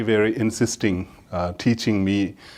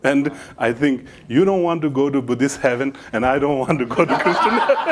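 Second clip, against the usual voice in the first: laughing; 4 semitones higher, 4 dB louder than usual. Real, not acted. Punchline delivered while laughing.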